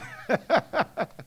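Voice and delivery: short burst of laughter. Real, not acted. Laughs at his own remark.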